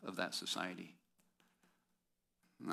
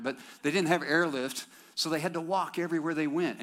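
much higher and louder, didn't punched hard; louder retake; 7 semitones higher, 7 dB louder than usual. Shown as a demonstration. Preacher repeats the line after a flat joke, louder. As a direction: push it out like a rally call. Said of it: "Nobody cares, all right?".

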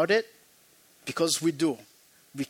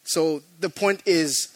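usual speaking voice

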